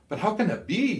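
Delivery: a high, startled cry of disbelief; startled outburst. A narrator's reading. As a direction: blurt it out in shock. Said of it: "Scholl let out".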